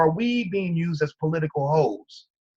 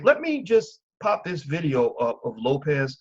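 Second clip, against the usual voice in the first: ordinary talk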